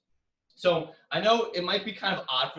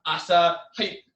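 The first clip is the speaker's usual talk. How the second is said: louder shouted sample kiai; karate yells; 2 semitones higher, 9 dB louder, slower than usual. Shown as a demonstration. Teacher listing the yells people use, shouting them. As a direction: bark out a few example yells. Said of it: "Some people yell".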